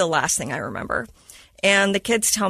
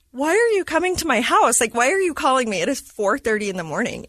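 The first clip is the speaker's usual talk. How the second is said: high, louder mock outrage, easing by the end; incredulous protest; 6 semitones higher, 4 dB louder, faster than usual. Retelling her reply to mom's early-morning call, incredulous. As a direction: retell a baffled objection, pitched right up.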